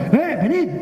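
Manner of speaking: high-pitched hail from a boat; acted "come on" call. Second boat hails them.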